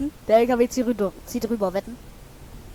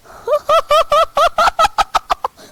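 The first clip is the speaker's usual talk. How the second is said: high burst of laughter; high giggling burst; laughing; 12 semitones higher than usual. Real, not acted. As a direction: crack up helplessly.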